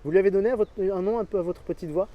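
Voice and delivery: ordinary delivery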